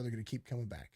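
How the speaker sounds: usual speaking voice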